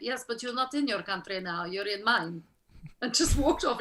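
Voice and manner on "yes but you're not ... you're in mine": deadpan Romanian accent, softly put on. Romanian man puts actress in her place, deadpan Romanian accent. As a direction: answer coolly in a Romanian accent. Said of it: "he just looked at me and went".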